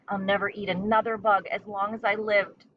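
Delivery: plain reading voice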